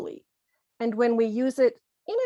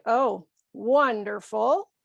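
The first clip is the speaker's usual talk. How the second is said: slow, drawn-out sarcastic praise. Acted. Parent to child who failed a test, sarcastic. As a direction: feign delight with slow sarcasm. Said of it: "so that is certainly sarcasm".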